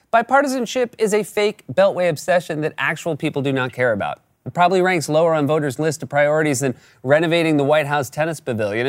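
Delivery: normal talking voice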